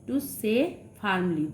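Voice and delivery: very slow, word by word; slow dictation. Shown as a demonstration. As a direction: read it slowly for the class to copy. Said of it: "dictating meaning". (ধীরে ধীরে পড়ে শোনানো)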